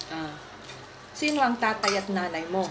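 ordinary delivery

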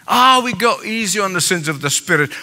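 ordinary delivery

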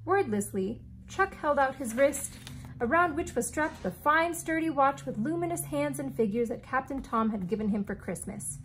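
ordinary delivery

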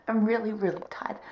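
soft whisper; tired whisper. Real, not acted. Telling viewers she is off to bed, whispered. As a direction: breathe it out sleepily.

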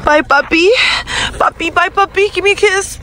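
high, cooing pet voice. Real, not acted. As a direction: coo goodbye to the puppy. Talking to the puppy.

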